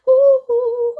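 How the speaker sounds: high, loud, gleeful ooh; excited ooh. Real, not acted. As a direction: whoop with delight. Excited vocalizing.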